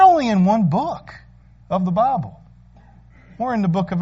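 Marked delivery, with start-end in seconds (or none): none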